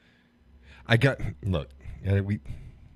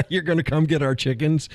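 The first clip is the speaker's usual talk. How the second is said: laughing; 10 semitones higher, 5 dB louder than usual. Real, not acted. Mock outrage at chicken threat.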